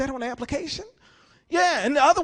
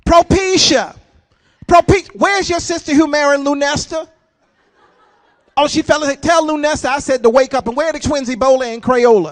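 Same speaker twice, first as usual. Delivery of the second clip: yelling mom; 2 semitones higher, 11 dB louder than usual. Retold or imitated. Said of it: "she kept yelling at them".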